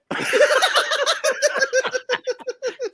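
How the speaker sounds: high howling laugh; group bursts out laughing at the story. Real, not acted. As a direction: break down laughing.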